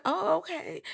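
high, breathy-free startled oh okay. Retold or imitated. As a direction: react like you just got scolded. Reacting to mother's rant.

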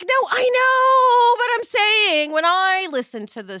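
drawn out and emphatic; insistent self-quote. Retold or imitated. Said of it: "I was like".

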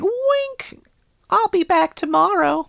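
high squeaky cartoon cat; Cat in the Hat voice. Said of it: "and then he's like".

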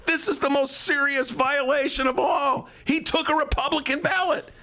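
high-pitched, scandalized proclamation; mock outrage. Host reveals the lawyer's ballot to his producer, mock outrage.

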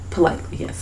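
breathy unvoiced whisper; a soft aside whisper. Real, not acted. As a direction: say it under your breath. Whispered agreement.

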